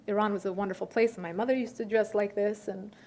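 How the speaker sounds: usual speaking voice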